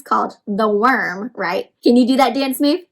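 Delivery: ordinary delivery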